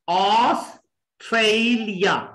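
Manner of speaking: Australia slowly in three parts, aws, trei, lia; split into syllables. Shown as a demonstration. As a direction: break Australia apart with pauses. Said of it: "there are three". (Australia रुक-रुक कर तीन हिस्सों में)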